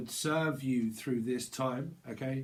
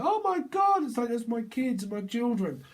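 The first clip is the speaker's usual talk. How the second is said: high gasp of joy; 9 semitones higher than usual. Retold or imitated. Parent seeing their children come out of the smoke, high joyful surprise. Parent sees kids emerge.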